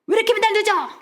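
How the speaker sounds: high-pitched mock scolding; impatient complaint. Rushed husband to dawdling wife, high exasperated nagging. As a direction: imitate a husband in a rush. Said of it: "나는 바빠 죽겠는데 아내가 막 꼼질거려".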